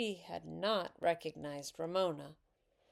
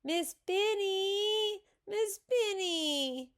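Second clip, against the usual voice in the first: very high, drawn-out wail for the teacher; upset child's high, slow call; crying out; 14 semitones higher, 4 dB louder, slower than usual. A narrator's reading. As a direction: call for help in a long whine. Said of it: "she cried".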